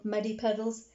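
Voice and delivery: plain reading voice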